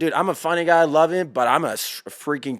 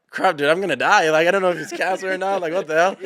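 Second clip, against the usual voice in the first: mock panic; 5 semitones higher, 4 dB louder than usual. Retelling fear of cancer.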